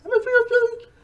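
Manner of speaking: very high, loud mock Aussie accent; squeaky bad Australian accent. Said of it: "my terrible impression of Australian accent".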